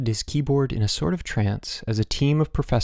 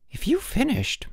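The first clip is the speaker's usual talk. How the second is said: high-pitched woman's exclamation; exclaiming; 3 dB quieter than usual. A narrator's reading. Headmaster's wife hears the boys have finished, exclaiming. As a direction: exclaim in disbelief, voice way up. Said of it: "Missus Sturgeon exclaimed".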